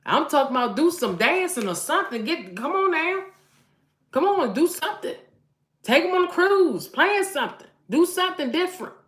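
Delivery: high, loud, excited exhortation; rally the women, voice soaring up; fired-up urging. Real, not acted. Preacher-mom urging wives to spoil their husbands, loud and fired up.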